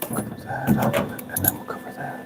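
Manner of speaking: breathy whispered chant; whispered singsong. Acted. Humming the stuck line while working, in a whisper. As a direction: whisper the catchy line to yourself. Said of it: "Is that stuck in anyone's head?".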